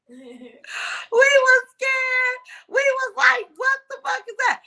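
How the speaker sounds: high, slow, frightened retelling; scared. Retold or imitated. Retelling a scary moment.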